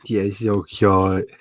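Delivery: slow, cooing pet voice. Real, not acted. Cooing at the dog again, slow baby talk. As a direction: gently coo at the dog.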